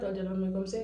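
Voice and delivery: usual speaking voice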